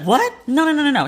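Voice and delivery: imitating a friend's startled objection; shocked protest. Friend objecting to the hostess, high shocked protest.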